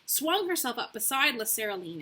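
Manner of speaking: ordinary delivery, nothing marked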